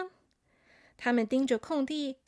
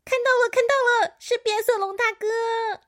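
high, loud excited little hamster; fast, high-pitched delight; happy; 10 semitones higher, 6 dB louder than usual. A narrator's reading. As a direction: squeal the discovery with glee. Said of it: "小朵开心地说".

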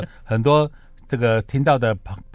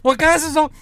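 mock innocent; 14 semitones higher, 4 dB louder than usual. Mock reversal joke on show.

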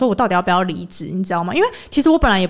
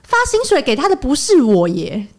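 heated retort; 7 semitones higher, slower than usual. Retold or imitated. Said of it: "他又比着我说".

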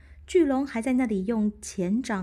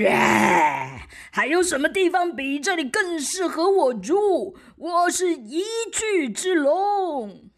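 loud booming dragon, slow at end; proud monster bellowing; huge fiery dragon roar; 8 semitones higher, 7 dB louder than usual. Acted. Dragon boasts.

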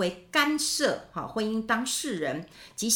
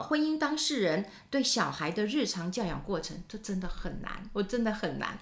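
normal talking voice

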